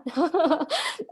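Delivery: high embarrassed laugh through tears; a small laugh to cover the tears; teary laugh. Real, not acted. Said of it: "getting quite emotional".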